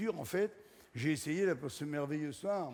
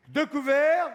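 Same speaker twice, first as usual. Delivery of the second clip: imitating a waiter; 7 semitones higher, 8 dB louder, slower than usual. Waiter asking about covers.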